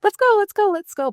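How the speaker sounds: high, loud pumped-up "let's go"; rally everyone like a go-getter; go-getter cheer. Retold or imitated. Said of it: "I'm like a go-getter".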